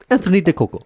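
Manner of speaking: high, loud mock Italian name; Italian accent. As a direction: say it like a proud Italian.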